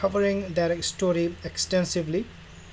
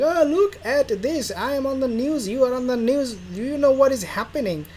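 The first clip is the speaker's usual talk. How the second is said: mock excited Harry; 7 semitones higher, 4 dB louder than usual. Harry calling Meghan about the news, excited and higher. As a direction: play Harry, thrilled on the phone.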